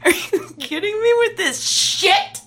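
high, drawn-out scoffing outburst; slow, high-pitched, incredulous anger; incredulous outrage. Acted. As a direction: throw it back at them in disbelief. Anna explodes at her captor's pitch.

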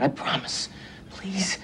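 whispered pleading. Real, not acted. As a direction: keep it to a breath. Hushed pleading to wife.

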